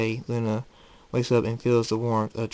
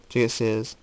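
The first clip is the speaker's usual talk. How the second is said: ordinary delivery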